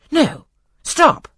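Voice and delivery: urgent interruption. Acted. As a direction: cut him off sharply. Colonel Dent interrupts magistrate.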